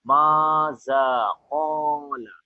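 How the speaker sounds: "maza qala" with long, drawn-out vowels; long mad elongation. Shown as a demonstration. Teacher demonstrating mad to students, "maza qala" stretched out slowly. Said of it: "the Arabic letter in long accent".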